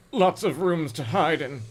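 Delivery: plain reading voice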